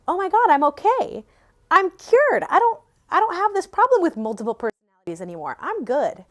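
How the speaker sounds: high-pitched, retold delighted realization; mock-triumphant announcement of being fine; elated relief. Telling herself the trauma work cured her, elated.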